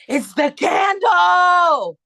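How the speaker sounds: high, loud, slow shouted realization; excited shout. Retold or imitated. Shouting downstairs after finding the candle's scent. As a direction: belt it out in delighted amazement.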